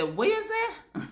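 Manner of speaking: high-pitched puzzled question; confused what you say. Retold or imitated. Hearing fast-talking Jamaicans, a high puzzled question. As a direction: ask it as if you missed every word. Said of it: "you have to say".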